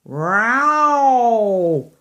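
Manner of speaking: drawn-out yowling cat; a cat wailing from a branch. Acted. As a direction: yowl it like a stuck cat. Cat yowl sound in story.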